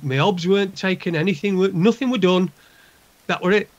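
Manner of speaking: normal talking voice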